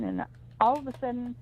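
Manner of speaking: ordinary talk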